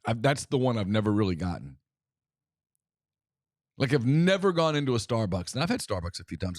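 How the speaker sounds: ordinary delivery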